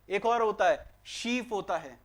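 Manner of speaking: normal talking voice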